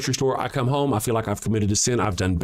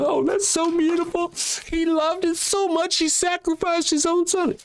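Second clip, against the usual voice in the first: very high, cooing mock adoration; mock gushing believer. Mocking programmed Christians praising the sacrifice, high cooing voice. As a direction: gush like a starry-eyed churchgoer. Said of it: "would you be sitting there going".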